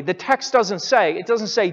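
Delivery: ordinary talk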